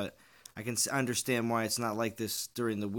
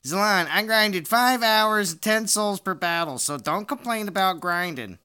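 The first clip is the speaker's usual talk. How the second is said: Pisces Shark voice; 8 semitones higher, 8 dB louder than usual. Retold or imitated. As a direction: pitch it way up and drag it out loud. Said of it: "I'm gonna do it in Pisces Shark voice".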